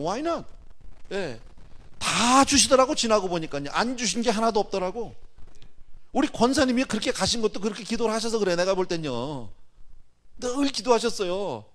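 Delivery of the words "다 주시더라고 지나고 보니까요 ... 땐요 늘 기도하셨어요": preach. Real, not acted. Preaching at full force.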